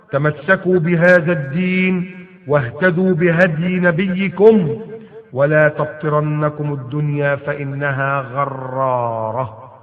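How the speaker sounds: slow, solemn classical Arabic recitation. Acted. Preacher reciting the caliph's words to the crowd, slow and solemn. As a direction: intone each word slowly like a preacher.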